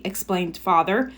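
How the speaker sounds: usual speaking voice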